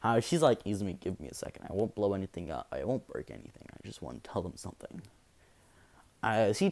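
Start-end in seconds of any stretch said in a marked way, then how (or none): 0.6-5.0 softer, hushed and quick at first; quiet plea. Acted. Deku asking Izumi for a moment, hushed. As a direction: keep it low, almost to yourself.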